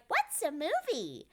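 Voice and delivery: lightly voiced innocent princess; princess in character. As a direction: play the puzzled Disney princess. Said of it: "'cause they'll be like".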